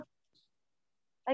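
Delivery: choppy, cutting out call audio; broken-up voice over laggy line; bad connection. Real, not acted. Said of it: "Masih putus-putus".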